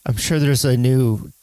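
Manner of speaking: usual speaking voice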